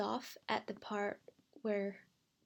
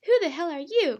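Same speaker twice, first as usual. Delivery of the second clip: loud, high startled yell; 7 semitones higher, 10 dB louder than usual. A narrator's reading. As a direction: yell the question out, pitch jumping. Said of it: "Crystal yelled out".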